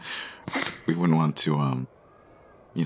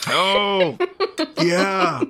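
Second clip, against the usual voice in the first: laughing; 7 dB louder than usual. Acted. Laughing at the awful wine.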